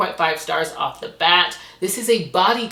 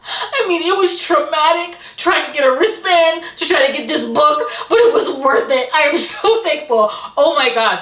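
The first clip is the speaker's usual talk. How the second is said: high, loud, laughing gush of gratitude; bubbling over with laughter and thanks; giddy gushing; 9 semitones higher, 7 dB louder than usual. Real, not acted. Gushing over a long-awaited book.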